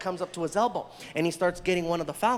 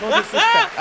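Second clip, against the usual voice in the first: high loud laugh into no disrespect; loud laughing apology; 12 semitones higher, 10 dB louder than usual. Real, not acted. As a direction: burst out laughing then apologize.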